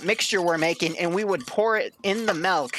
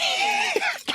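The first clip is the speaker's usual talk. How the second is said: burst of laughter. Real, not acted. Host laughs at crude joke.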